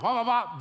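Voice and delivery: loud, high, rapid sound effect; demonstrated "wah" noise. Shown as a demonstration. Preacher showing people what to say to the Lord at home, loudly. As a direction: fire off three excited wahs.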